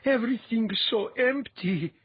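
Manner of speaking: shuddering voice. A narrator's reading. Latha shaken after hearing the void, shuddering. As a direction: voice trembling with dread. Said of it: "Latha said in a shuddering voice".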